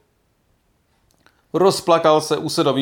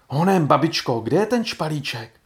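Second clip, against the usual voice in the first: put-on mildly impatient, eager child; eager calling; 3 semitones higher than usual. A narrator's reading. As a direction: urge grandmother on eagerly. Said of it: "a volal". (dychtivé dětské volání)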